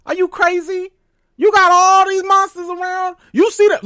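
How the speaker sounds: loud, high exasperated yell at the screen; yelling at Tabitha on the TV, fed up. Retold or imitated. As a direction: scream at a character who won't listen. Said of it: "I screamed at Tabitha through my T V".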